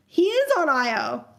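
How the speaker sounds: normal talking voice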